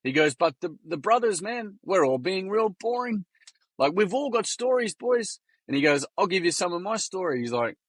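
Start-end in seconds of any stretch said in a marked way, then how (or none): 0.3-5.4 much higher Kiwi boy impression; rally the boys in a high put-on Kiwi voice; Kiwi accent. Kiwi legend addresses the brothers, high Kiwi accent. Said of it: "Excuse my Kiwi accent". 6.2-7.4 raised-pitch Kiwi boy impression; Kiwi accent. Kiwi boy offers his story to the room, higher Kiwi accent. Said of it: "Excuse my Kiwi accent".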